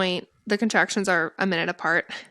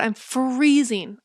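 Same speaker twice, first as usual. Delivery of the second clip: drawn out; 4 semitones higher, much slower than usual. Real, not acted. Cold after the shower.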